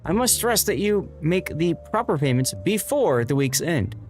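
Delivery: formal letter read in a lifted voice; prim landlord's letter. Acted. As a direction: read it like a stern, proper letter.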